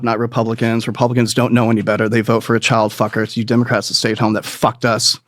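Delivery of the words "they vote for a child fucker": normal talking voice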